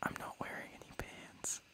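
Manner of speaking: hushed, voiceless confession; whisper. Real, not acted. Host shares a secret with the podcast, whispering. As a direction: whisper it close into the microphone. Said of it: "I'm gonna whisper it into the microphone".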